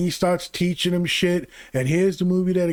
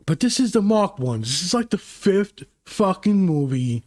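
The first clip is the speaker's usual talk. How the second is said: ordinary delivery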